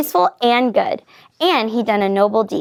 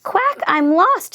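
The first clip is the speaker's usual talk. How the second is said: high, slow little duckling voice; tiny, drawn-out plaintive quack; small, sad voice; 8 semitones higher, slower than usual. A narrator's reading. Lost duckling alone in the woods, small sad voice. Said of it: "a small, sad voice".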